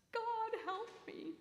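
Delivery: high, pleading cry to heaven; a desperate plea, high and thin. A narrator's reading. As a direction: call up to the heavens for help. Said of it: "he cried out to the heavens".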